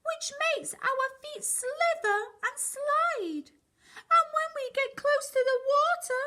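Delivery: very high, quick penguin chatter; high fast penguin voice. Acted. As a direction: squeak excitedly like waddling penguins.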